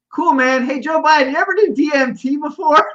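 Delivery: lightly voiced stoner host, casual; mock Joe Rogan. Imitating Rogan asking Biden about DMT.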